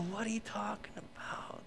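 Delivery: whispered disbelief at flattery; puzzled whisper. Acted. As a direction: whisper it as a wary aside. Acts out reaction to excessive flattery.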